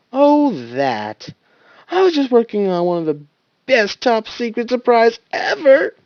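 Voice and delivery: from bored to excited, rising; bored turning excited. Acted. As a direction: start bored, get carried away. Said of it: "he exclaimed, trying to sound bored at first".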